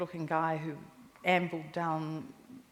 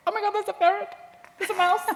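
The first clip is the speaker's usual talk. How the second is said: high, loud squeal of panic; hammed-up shriek at a scurrying animal; mock alarm; 8 dB louder than usual. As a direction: squeal it way up high, comically horrified. Acting out alarm at seeing animals.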